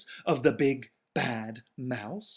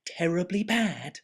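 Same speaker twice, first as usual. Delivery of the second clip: put-on child's question; 9 semitones higher, 8 dB louder than usual. Child asks father about mouse.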